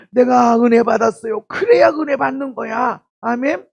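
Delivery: mock-ecstatic churchgoer; joyful testimony. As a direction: shout it like you've been moved. Congregant testifies with joy.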